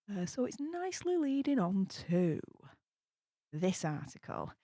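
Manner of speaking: usual speaking voice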